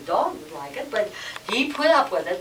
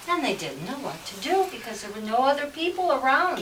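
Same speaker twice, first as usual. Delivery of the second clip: ordinary delivery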